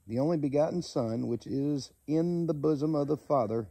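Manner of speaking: measured, solemn Bible reading; steady preacher reading John aloud; scripture reading. Acted. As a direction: read the verses aloud like a preacher.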